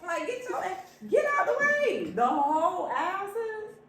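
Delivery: high pitched exasperation; exasperated. Real, not acted. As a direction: get worked up about it. Exasperated complaint.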